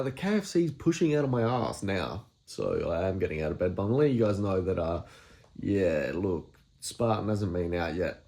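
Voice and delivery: ordinary delivery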